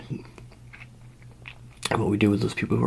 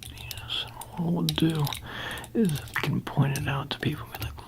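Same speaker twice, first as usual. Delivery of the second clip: usual speaking voice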